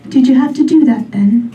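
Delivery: lightly voiced British accent, laughing and reproachful; a scolding British accent, half laughing. Her vagina scolding her in a light British accent. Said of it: "she says in her British accent".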